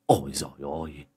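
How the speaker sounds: low, soft "oh my god"; dismayed groan. A narrator's reading. Uncle hearing the boy's confession, low dismayed groan.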